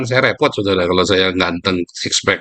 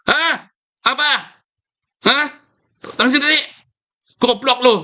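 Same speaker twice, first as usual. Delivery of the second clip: high, harsh mock snap at the wife; gruff husband now. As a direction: bark it like a fed-up husband. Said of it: "Kalau sekarang".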